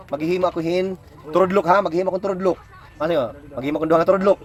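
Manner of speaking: ordinary talk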